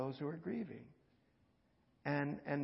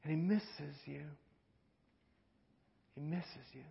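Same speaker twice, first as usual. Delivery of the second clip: tender; 4 dB quieter than usual. Acted. Tender repeat.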